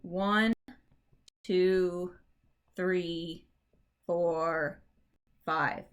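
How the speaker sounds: each count set apart by pauses; counting out the feet. Shown as a demonstration. Teacher counting five feet aloud, slow and spaced. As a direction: count the beats out one by one. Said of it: "So that would be, like".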